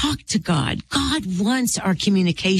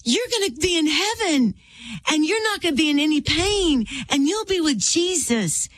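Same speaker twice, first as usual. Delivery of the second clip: put-on high, crying-out little boy voice; flailing excited child; 10 semitones higher than usual. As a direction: imitate an excited son calling to Daddy. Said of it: "with flailing in the water".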